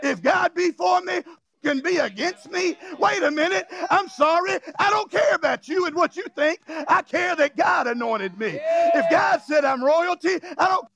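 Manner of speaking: rousing pulpit declaration; preaching. Real, not acted. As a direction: preach it boldly to the congregation. Preaches at full force.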